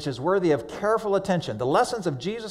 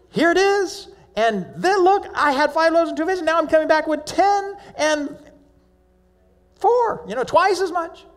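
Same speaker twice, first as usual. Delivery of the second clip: put-on excited boy; 12 semitones higher than usual. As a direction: show off the miracle to mom. Boy showing mom the food.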